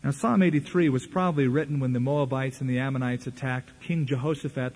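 normal talking voice

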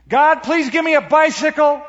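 high, loud mock-child holler; kid yelling a prayer. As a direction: belt out the plea so Grandma next door hears. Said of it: "you don't have to yell".

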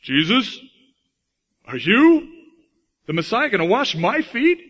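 high, loud startled blurt. Acted. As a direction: gasp it out in disbelief. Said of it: "he blurted out".

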